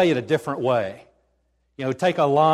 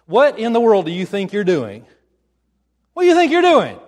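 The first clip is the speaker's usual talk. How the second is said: loud, indignant challenge building higher; outraged owner; 5 semitones higher, 9 dB louder than usual. Acted. Owner confronting disciples untying the colt, outraged shout. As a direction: shout it like catching thieves red-handed.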